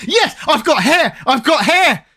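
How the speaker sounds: high-pitched, drawn-out mock jubilation; jolly waking cry. Re-enacting waking from a balding dream, jolly high-pitched relief. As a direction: rejoice like a relieved Scrooge, acted. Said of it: "he wakes up all jolly the next day going".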